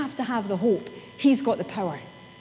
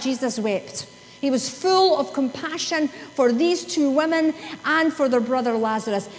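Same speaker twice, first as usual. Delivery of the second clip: normal talking voice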